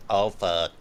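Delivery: ordinary delivery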